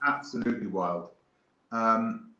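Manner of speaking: ordinary talk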